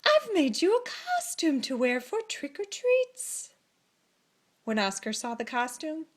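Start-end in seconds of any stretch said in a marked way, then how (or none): 0.0-3.5 lightly voiced, higher doting mother. A narrator's reading. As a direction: present the gift sweetly, a touch higher. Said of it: "said Oscar's mother".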